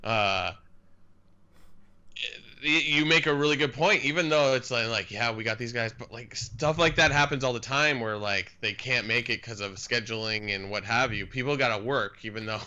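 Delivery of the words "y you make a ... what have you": ordinary talk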